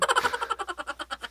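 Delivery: sudden squealing laugh at own joke; high burst of laughter. Real, not acted.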